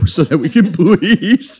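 laughing through the words. Real, not acted. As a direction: plead while laughing. Laughing plea for toilet paper.

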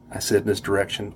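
ordinary delivery

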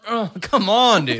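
loud, high outraged protest. Real, not acted. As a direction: protest the foul loudly. Outraged at dirty strike.

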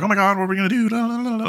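high-pitched panicky babble; imitating flustered sidekicks; mock freak-out. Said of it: "Pain and Panic are freaking out".

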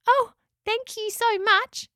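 very high, slow, tiny voice; little echidna. A narrator's reading. Spike thanks the pelicans for freeing her, little echidna voice. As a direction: squeak out your thanks.

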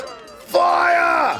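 high shouted command; yelled order. Retold or imitated. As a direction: bark the order to shoot. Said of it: "someone yells".

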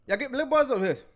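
high, run-together mock Jamaican accent; slurred accent imitation. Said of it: "she spoke in cursive".

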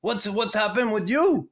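a hint of a Quebec coach; French-Canadian coach. Coach singling out a defenseman, in a light French-Canadian accent. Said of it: "He says".

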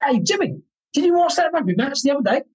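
mock call-out, pitched high; retold breezy hail across the room; calling to a mate. Imitates workmate ignoring him, calling out.

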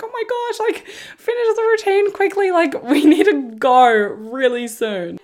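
high, panicky plea to hurry up; frantic urging. Retold or imitated. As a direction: beg him to wrap it up fast, rising in pitch. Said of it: "And we're like".

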